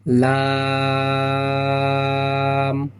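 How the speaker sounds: লাম stretched over four alifs; long drawn sound. Shown as a demonstration. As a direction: hold লাম long and even. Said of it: "এখানেও চার আলিফ টান হবে". (লাম চার আলিফ লম্বা টেনে)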